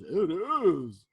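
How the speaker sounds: very loud, drawn-out reply; big elephant voice. A narrator's reading. Elephant agrees with Anansi about heat, big booming voice. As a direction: boom it out as a huge animal.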